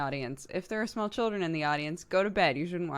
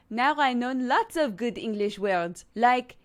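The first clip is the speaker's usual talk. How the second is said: put-on higher-pitched French-accented schoolgirl; 5 semitones higher, 4 dB louder than usual. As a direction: speak up in a raised French accent. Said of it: "Dodger's French accent is really good".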